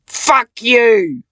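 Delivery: loud, snarled curse; furious shout. Real, not acted. Cursing Satan out of her head, furious shout. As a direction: spit it out in rage.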